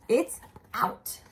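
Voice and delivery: plain reading voice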